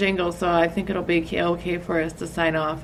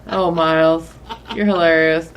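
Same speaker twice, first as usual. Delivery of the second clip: laughing; 2 semitones lower, 7 dB louder, slower than usual. Real, not acted. Laughing after rant.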